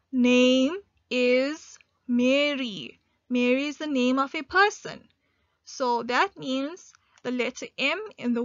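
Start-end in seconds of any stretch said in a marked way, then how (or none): 0.1-3.0 very slow and a bit louder; slow dictation read. Shown as a demonstration. Teacher dictating the rest of the sentence, very slow. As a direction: stretch each word for the class. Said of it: "continue with our sentence".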